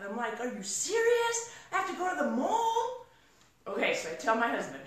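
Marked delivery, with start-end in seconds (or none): 0.4-3.1 scared disbelief. Retold or imitated. Scared woman answering the little voice in her head, high and incredulous. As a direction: protest in high-pitched mock dismay. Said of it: "I was scared to death".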